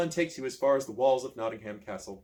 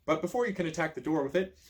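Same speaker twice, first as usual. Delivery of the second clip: usual speaking voice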